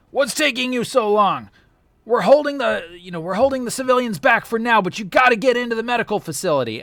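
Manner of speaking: high, loud, fast urgent call; shouting over comms. Acted. Trooper on comms urging the team to hurry, high, loud and fast. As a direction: yell it like a trooper under pressure. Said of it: "you hear".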